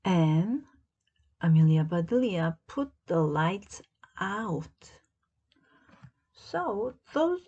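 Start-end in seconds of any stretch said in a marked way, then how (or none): none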